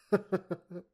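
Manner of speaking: a loud, high villain's cackle; loud, high gloating laugh at the captive's ruin; evil laugh. Acted. Villain gloats over doomed contacts.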